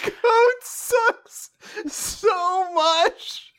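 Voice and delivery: very slow, drawn-out whine while laughing. Real, not acted. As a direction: stretch each word, half laughing, half wailing. Mocking loser through laughter.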